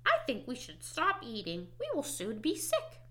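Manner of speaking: ordinary delivery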